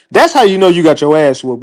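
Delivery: ordinary talk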